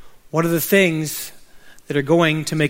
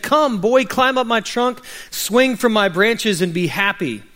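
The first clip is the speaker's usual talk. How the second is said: higher-pitched, eager invitation, easing at the end; joyful; 6 semitones higher than usual. A narrator's reading. Happy tree invites the boy back to play, joyful. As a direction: call the boy in with joy. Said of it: "the tree shook with joy".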